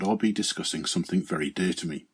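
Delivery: ordinary talk, nothing marked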